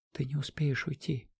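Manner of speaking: hushed urgent whisper. Acted. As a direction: whisper it so no one hears. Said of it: "прошептала она".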